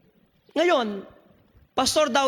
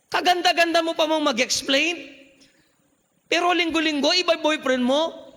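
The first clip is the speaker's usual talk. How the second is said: mocking accusers; 4 semitones higher than usual. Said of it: "Anong sasabihin ng tao?".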